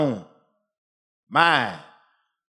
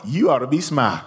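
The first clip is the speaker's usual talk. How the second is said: high, fervent preaching climb; 7 semitones higher than usual. Acted. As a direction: build the sermon to a peak. Preaching builds to a peak.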